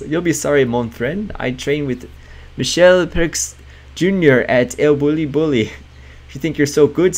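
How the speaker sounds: put-on an indignant chef in a thick French accent; a loud, accosting French chef accent. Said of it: "In a thick French accent".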